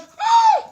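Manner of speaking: high-pitched acted gasp; sharp, high startled intake. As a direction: gasp in mock shock. Helpy startled once more.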